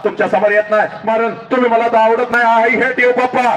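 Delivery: hurt daughter rejecting her father, high; rising resentment. Acted. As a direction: pitch climbing high into bitter hurt.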